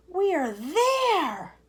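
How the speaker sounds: very slow, high, loud announcement; cheerful old grasshopper. Acted. Old grasshopper cheerfully announcing arrival. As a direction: draw it out to cheer them. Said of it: "trying to be cheerful".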